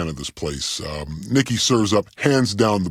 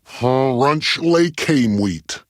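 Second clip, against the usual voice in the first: very slow, drawn-out catchphrase; ultimate catchphrase; 3 semitones higher, much slower than usual. Shown as a demonstration. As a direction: deliver it syllable by syllable for the listeners. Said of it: "I'll give it to you one more time".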